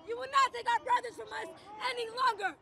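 ordinary talk

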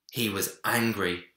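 usual speaking voice

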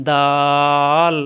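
দাল held long and level, closing with the tongue back at the teeth; articulation demo. Shown as a demonstration. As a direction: draw out the vowel of দাল for students to copy. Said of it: "ছেড়ে দিয়ে আবার ওখানে লাগাতে হবে". (দাল লম্বা করে টেনে উচ্চারণ)

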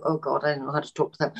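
low, quick, softened aside; retold awkward dread. Someone facing people who assume they're known, low dread. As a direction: imitate a quick, private inner groan. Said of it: "And you go".

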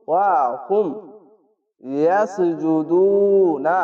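slow, drawn-out chanted recitation; Quran recitation. Shown as a demonstration. Teacher demonstrates for students: slow melodic recitation. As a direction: intone the word like tajweed reading. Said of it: "এখানে আয়াত আছে".

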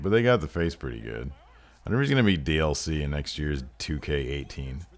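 usual speaking voice

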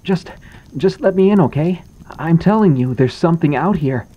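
high, loud desperate begging; a frightened boy pleading through the door, high and loud. A narrator's reading. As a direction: beg them to let you in before it's too late. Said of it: "Landon pleaded".

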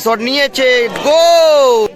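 shot call rising into a goal shout. Real, not acted. Commentator calls a goal.

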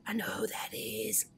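breathless whisper after a gasp; whispered aside. Real, not acted. Gamer recognises the woman in the diary, whispering. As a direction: hush it, recognising her.

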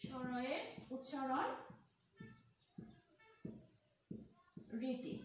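word by word with long pauses; drawn-out words, spaced far apart; slow dictation. Shown as a demonstration. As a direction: dictate slowly for students writing it down. Teacher dictates while writing it out.

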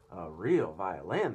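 surprised cry. Acted. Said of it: "grasshopper cried".

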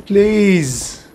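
high, drawn-out plea; pleading whine. Pretend. Player begging the game for a bonfire, pleading whine. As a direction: beg with a long falling whine.

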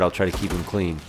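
ordinary delivery

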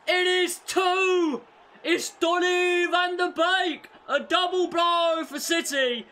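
high, loud, elated yelling; ecstatic goal shout. Real, not acted. Gamer yelling at his screen, ecstatic and loud. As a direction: scream it like your team just scored.

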